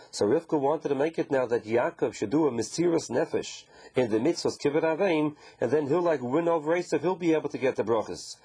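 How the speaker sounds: ordinary talk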